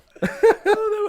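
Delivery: loud, very high laugh; helpless giggling burst; high laughing fit. Real, not acted. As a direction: squeal with laughter. Both cracking up.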